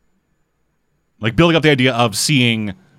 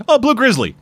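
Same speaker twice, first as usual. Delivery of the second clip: lightly voiced hero, much higher and breezy; casual mock reply; 12 semitones higher than usual. Host voices the hero explaining his bear, much higher and casual. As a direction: toss it off like it's nothing. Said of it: "It's like".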